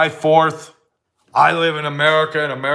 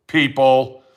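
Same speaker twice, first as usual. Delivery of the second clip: normal talking voice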